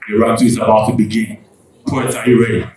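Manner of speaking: booming, fast hype announcement into the mic; rally the crowd like a slam host; big MC announcement to pump up the room; loud announcer call. Real, not acted.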